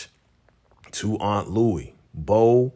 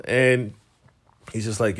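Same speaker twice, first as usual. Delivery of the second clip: ordinary talk, nothing marked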